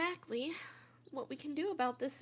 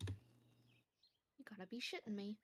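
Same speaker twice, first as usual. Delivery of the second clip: muttered; 6 semitones lower, 16 dB quieter, slower than usual. Acted. Disbelieving aside, hushed.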